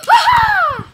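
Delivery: loud high startled scream. Real, not acted. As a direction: let out a sudden shriek. Bird escapes.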